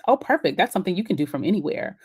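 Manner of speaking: normal talking voice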